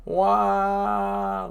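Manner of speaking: a wailing whale cry; a giant whale bawling. Acted. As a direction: wail like a heartbroken whale. Whale Laboon crying.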